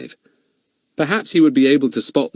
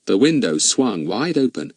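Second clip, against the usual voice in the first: ordinary delivery, nothing marked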